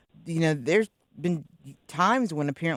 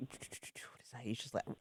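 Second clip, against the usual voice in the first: whispering; 3 semitones lower, 19 dB quieter than usual. Real, not acted. Searching for a name, whispered aside.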